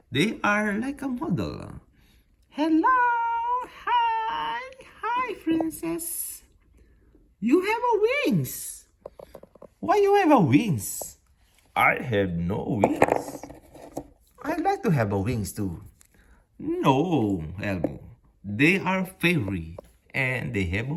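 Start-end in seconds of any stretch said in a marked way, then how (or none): 2.5-11.2 high, loud, delighted hello; excited greeting. Pretend. Meeting the fairy princess. 11.8-18.0 a hint of a low monster voice; lightly voiced monster sulking about wings; lightly voiced Cookie Monster.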